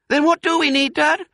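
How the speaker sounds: very high, loud cub voice; eager little fox. A narrator's reading. Smallest fox asks Dad, high and eager. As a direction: ask eagerly in a piping voice.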